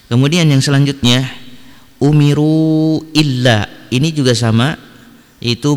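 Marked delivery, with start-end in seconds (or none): 2.0-3.7 UMIRU ILLA with the final u of umiru held long; slow Quran recitation, stretching the "ru" before illa; recited long vowel. Shown as a demonstration. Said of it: "Dibaca dua sampai empat harokat".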